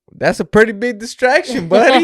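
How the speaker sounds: high, loud cop voice, teasing; mock cop. Retelling the cop's words.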